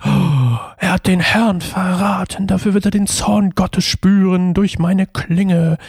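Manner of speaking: slow, drawn-out, hammed-up vow of vengeance; mock wrathful avenger. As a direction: stretch each word like a melodramatic biblical warrior. Mimics wrathful biblical avenger's vow. (übertrieben pathetischer Racheschwur, langsam gedehnt)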